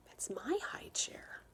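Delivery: soft unvoiced whisper; hurt little boy whispering. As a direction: Peter whispers about his chair. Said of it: "Peter whispered".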